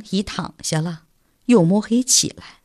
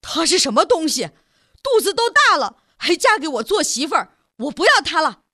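higher, seething voice through clenched teeth; teeth-gritted rage; 8 semitones higher, faster than usual. A narrator's reading. Son rages at his mother about the bride, teeth clenched. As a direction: spit it out like a cheated brute. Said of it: "咬牙切齿地说".

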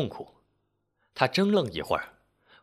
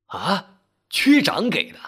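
high, loud disbelief; astonished; 7 semitones higher, 5 dB louder, slower than usual. A narrator's reading. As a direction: blurt it out, pitch leaping up in surprise. He learns where money came from.

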